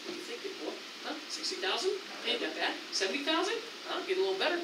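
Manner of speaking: hushed, coaxing sales patter; soft-voiced dealer working a buyer; car salesman. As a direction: float each price softly, like a sly offer. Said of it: "It's kinda like a car salesman".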